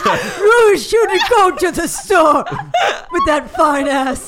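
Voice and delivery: slow, loud, hammed-up old man; comic old man calling out. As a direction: drag it out like a leering old-timer. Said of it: "Like an old man".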